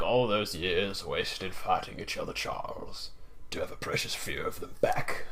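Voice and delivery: soft, low whisper, nearly breathless; hushed emotional whisper. Retold or imitated. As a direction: whisper it like a tender farewell. Voicing Xavier and Magneto.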